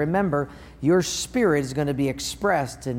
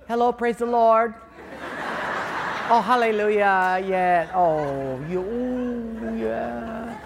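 sweet phone voice; 5 semitones higher, slower than usual. Retold or imitated. Sweet phone voice after yelling.

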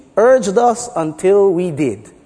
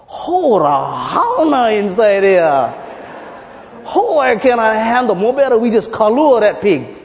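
Hawaiian pidgin complaint; 5 semitones higher, 4 dB louder than usual. Acted. Hawaiian guy back at the farmer's door, whining pidgin. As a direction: drag out the disgust in thick pidgin. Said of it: "it's the Hawaiian guy".

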